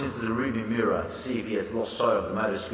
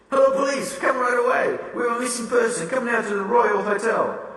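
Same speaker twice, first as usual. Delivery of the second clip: higher, urgent pleading to police; upset panic; 11 semitones higher than usual. Acted. Frances Tyler phoning police in distress, high and urgent. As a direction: beg for help in a rush. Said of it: "so upset she can barely manage to speak".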